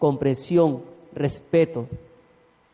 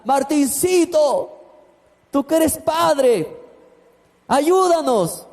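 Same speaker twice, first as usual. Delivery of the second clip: high, slow, begging voice; pleading neighbour; 8 semitones higher, much slower than usual. Retold or imitated. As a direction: beg the pastor to help, drawn out.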